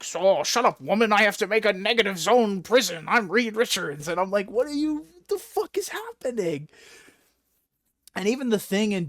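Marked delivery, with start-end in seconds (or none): 0.0-4.1 lightly hammed-up dismissive bluster; a hint of a pompous, bossy hero; mock self-important Reed. Reed brushing off his wife, lightly mocked bluster. Acting out obsessed Reed Richards. 4.5-6.7 high-pitched exasperated disbelief; incredulous outburst. Retold or imitated. Reader reacting to the negative zone prison, mock incredulous.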